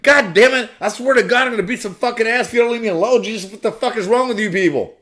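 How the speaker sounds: high loud enraged shout, fast; full-force furious shout; furious yell. Retold or imitated. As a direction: scream them away. Furious yelling at soldiers.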